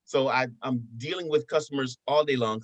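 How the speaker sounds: ordinary talk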